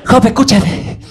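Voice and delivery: hushed urgent warning to Job; friend's warning. Retold or imitated. Classmate warning Job about his mother, urgently. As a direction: lean in and warn him.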